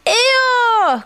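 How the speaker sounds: high, loud, drawn-out call; astonished cry. A narrator's reading. Friends see who floats out, astonished cry. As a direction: cry out the name in surprise. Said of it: "cried everybody".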